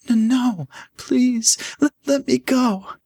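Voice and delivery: very high, stammering plea; acted scared young woman; frightened pleading. A narrator's reading. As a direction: beg to be released, pitch way up. Frightened girl pleading when grabbed.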